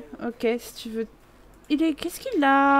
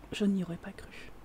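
whispered, much softer; 7 semitones lower, 15 dB quieter than usual. Shown as a demonstration. Player confiding to viewers in a whisper. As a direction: breathe it as a secret to the viewers. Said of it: "repeats it whispered".

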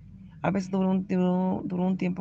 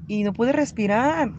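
louder, slowed, pleading cry; distressed cry; 5 semitones higher, 5 dB louder, slower than usual. Retold or imitated. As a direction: push it out as if air is running out. Reliving her overdose, gasping. (reviviendo la sobredosis, grito angustiado y lento)

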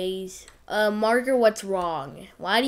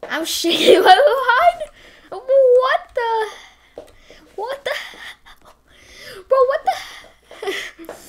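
high, loud shrieks broken by laughter; laugh-scream in disbelief; shrieking laughter; 14 semitones higher, 9 dB louder than usual. Real, not acted. Player shrieking at a twerking character, laughing.

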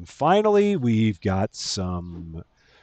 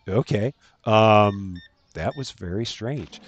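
normal talking voice

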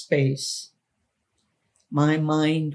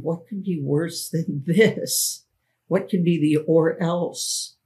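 plain reading voice

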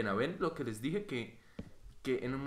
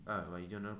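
ordinary delivery

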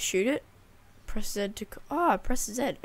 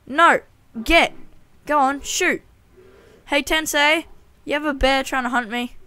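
high loud shooing; shouting; 5 semitones higher, 8 dB louder than usual. Real, not acted. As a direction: drive the bear off loudly. Shooing bear, calling.

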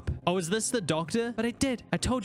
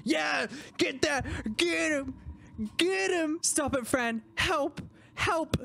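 high excited shouts; excited yelling; 8 semitones higher, slower than usual. Real, not acted. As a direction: cheer the attack on. Cheering during attack.

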